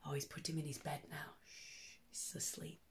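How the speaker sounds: hushed bedtime whisper. A narrator's reading. Reader hushing children while hare sleeps, whispered. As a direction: shh it so he stays asleep.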